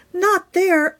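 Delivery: high sharp warning; urgent warning. Acted. Hen warning the mouse away from the blocks, high. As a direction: stop her with a high cry.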